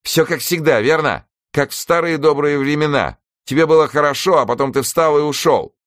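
high-pitched, bitter accusation; reproachful. Acted. Helen to Newson about him leaving, reproachful.

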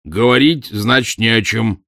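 firm, final announcement; decisive. A narrator's reading. Professor refusing to talk further to his visitor, decisive. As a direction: close the discussion decisively. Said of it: "решительно объявил он".

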